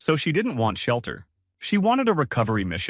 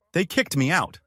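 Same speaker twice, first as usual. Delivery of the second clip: mildly irritated, raised pitch; a touch of annoyance, higher; 7 semitones higher than usual. A narrator's reading.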